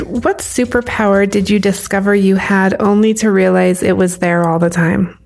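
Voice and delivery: ordinary delivery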